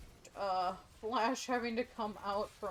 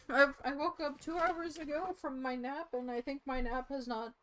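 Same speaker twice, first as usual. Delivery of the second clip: ordinary delivery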